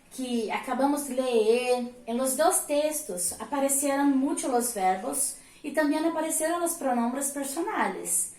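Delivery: usual speaking voice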